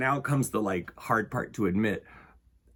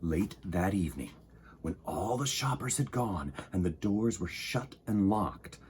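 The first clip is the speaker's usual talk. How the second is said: story narration; 5 dB louder than usual. A narrator's reading. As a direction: read to children, unhurried. Reading picture book aloud.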